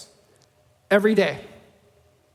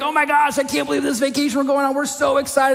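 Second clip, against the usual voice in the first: high, fast gushing about the trip; gushing excitement; 6 semitones higher, faster than usual. Retold or imitated. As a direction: bubble over about the vacation, mock thrilled. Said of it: "You're like".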